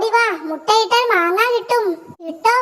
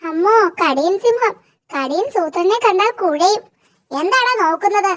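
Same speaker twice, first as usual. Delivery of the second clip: ordinary delivery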